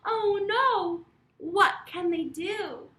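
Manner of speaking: loud, high mock panic; mock alarm. A narrator's reading. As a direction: gasp it out to thrill the kids. Dogs threaten, storyteller alarm.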